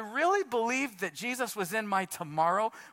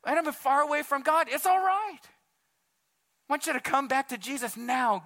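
higher, urgent preaching appeal; impassioned altar call; 4 semitones higher, 3 dB louder than usual. Real, not acted. Preacher urging the room to come back to Jesus, impassioned altar call. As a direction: press them to decide, voice raised.

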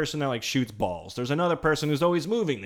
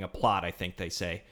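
usual speaking voice

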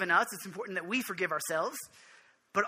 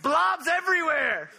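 high, loud, comic begging cry; pleading blind beggar; 7 semitones higher, 4 dB louder than usual. Pretend. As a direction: cry out desperately from the roadside, hammed up. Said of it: "I'd be like".

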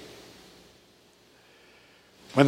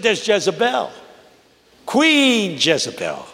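high, rising preacher's cadence building to a cry; full-force preaching; 8 semitones higher than usual. Acted. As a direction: preach it like a Baptist pulpit. Preaching the sermon retold.

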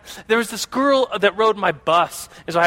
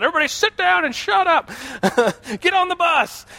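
much higher, mock-bossy bus driver barking orders; strict bus driver; 10 semitones higher than usual. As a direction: play the strict driver yelling at the kids, pitched way up. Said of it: "He'd always say that".

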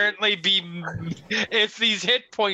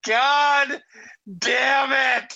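frustrated shout, pitched up; exasperated outburst; 8 semitones higher, much slower than usual. Real, not acted. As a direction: cry out in frustration, dragging it out. Player curses at a bad result.

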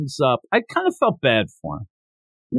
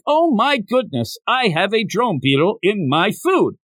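high, indignant complaint voice; high-pitched mock-appalled customer; outraged diner; 5 semitones higher than usual. Voicing outraged diner with bug in food.

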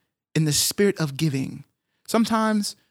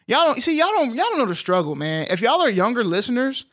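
animated teasing; 7 semitones higher than usual. Real, not acted. Teasing younger listeners about struggle.

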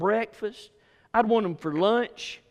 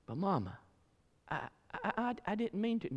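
stammering plea; 10 dB quieter than usual. Retold or imitated. Kid stammering excuse.

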